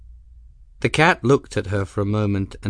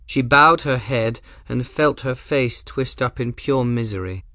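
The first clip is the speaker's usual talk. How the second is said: usual speaking voice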